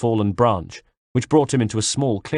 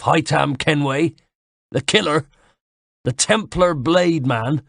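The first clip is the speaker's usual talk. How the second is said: mocking villain; 5 semitones higher than usual. Knife man mocks the young Kenway. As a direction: scoff at his reputation. Villain's long taunting speech.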